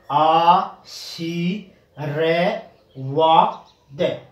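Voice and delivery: आ सी रे वा द said very slowly, each piece alone; teacher sounding out आ सी रे वा द; syllable-by-syllable demo. Shown as a demonstration. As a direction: pause after every syllable of आ सी रे वा द. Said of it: "ये सही उच्चारण ये होगा".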